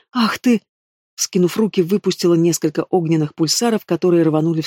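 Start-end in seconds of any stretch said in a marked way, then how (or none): 0.1-0.6 higher-pitched indignant exclamation; lightly acted outrage, pitched up; outraged outburst. A narrator's reading. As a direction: splutter with mild fury. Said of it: "взревела я".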